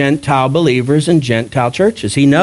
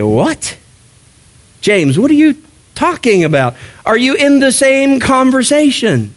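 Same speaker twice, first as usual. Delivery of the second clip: mock disbelief rising higher; imitating a baffled listener at James; incredulous reaction; 7 semitones higher than usual. Paul's side reacts to James, mock disbelief.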